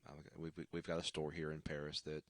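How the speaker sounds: ordinary talk, nothing marked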